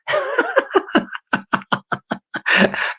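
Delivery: high wheezy string of laughter; laughing. Real, not acted. Host laughs at his own voice-changer joke, laughing. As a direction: crack up in a long, thinning laugh.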